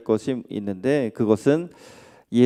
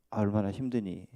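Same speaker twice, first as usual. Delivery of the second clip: much softer, hushed and caring; soft sympathy; 4 semitones lower, 11 dB quieter than usual. Pretend. Preacher shows the one comforting word for mourners, hushed. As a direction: say it barely above a breath, full of sympathy. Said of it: "그냥 말한다면".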